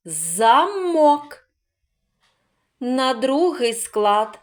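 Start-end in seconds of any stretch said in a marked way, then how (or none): 0.1-1.5 "Замок" in two held syllables, МОК higher and stronger; stress on second syllable. Shown as a demonstration. Teacher asks where stress falls, punching МОК in "Замок". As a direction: lean high on МОК in "Замок". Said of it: "На який склад падає наголос?".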